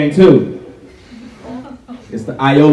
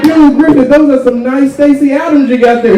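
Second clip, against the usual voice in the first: student compliment; 10 semitones higher than usual. Retold or imitated. Student complimenting Griffith's shoes, higher friendly voice. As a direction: flatter the teacher's shoes. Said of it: "You'd be like".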